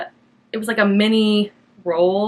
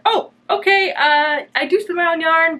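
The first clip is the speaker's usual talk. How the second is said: much higher, bright and friendly; cheerful polite reply to a dismissive clerk; light and bubbly; 8 semitones higher, 3 dB louder than usual. Retold or imitated. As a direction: keep it breezy despite the slight. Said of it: "try to keep things, like, light and bubbly".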